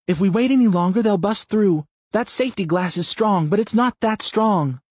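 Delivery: high, loud pressing appeal; urgent warning. A narrator's reading. Chandra warning Chris the glass won't hold, loud and urgent. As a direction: push him to act now.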